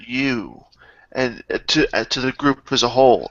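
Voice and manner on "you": mild disdain, dropping off on the last word; a touch of haughty manservant scorn; disdainful. Acted. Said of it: "with great disdain".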